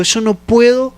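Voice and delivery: más agudo, quejándose ante Dios; súplica desesperada. Retold or imitated. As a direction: ruega a Dios con angustia contenida. (súplica angustiada, aguda)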